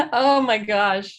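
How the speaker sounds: touched by the mother's story, she laughs and gushes; moved laughter. Real, not acted. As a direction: let the emotion spill out as a laugh. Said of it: "I'm gonna cry now".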